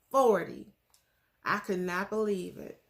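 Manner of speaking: ordinary talk, nothing marked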